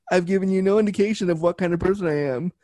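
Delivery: ordinary talk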